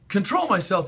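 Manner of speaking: high, loud indignant yell. A narrator's reading. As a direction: shout it back in outrage. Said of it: "yelled Fern".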